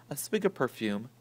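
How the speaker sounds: ordinary delivery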